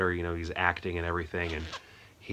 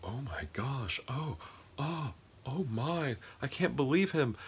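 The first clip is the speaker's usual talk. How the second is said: breathy high gasping awe, much softer; awed gasp; 6 semitones higher, 4 dB quieter than usual. Retold or imitated. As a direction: gasp it out in hushed amazement, pitched up. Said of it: "his cabinet are all like".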